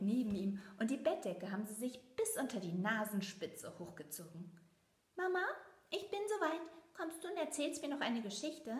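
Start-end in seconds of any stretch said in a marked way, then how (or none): 5.2-8.8 put-on high, eager child voice, pleading; a small mouse begging for a story; impatient little mouse. A narrator's reading. Little Leo calls impatiently to his mother. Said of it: "fragt Leo ungeduldig".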